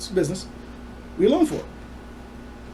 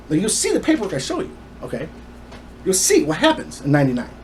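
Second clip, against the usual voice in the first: ordinary delivery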